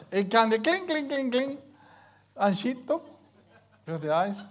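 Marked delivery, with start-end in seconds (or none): 0.6-1.6 high, loud clinking jingle; imitated chime. Husband showing how the prayer music interrupts, high imitated chime. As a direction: mimic an alert cutting in, high and insistent. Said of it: "in the middle of the conversation".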